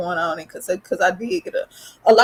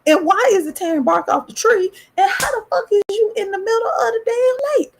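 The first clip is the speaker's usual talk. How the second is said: rising higher and higher into a near-crying cry; scared disbelief; 11 semitones higher than usual. Retold or imitated. Child at the pond, spotting the hairy creature, in rising panic. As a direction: panic climbs with each question. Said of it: "So I'm like".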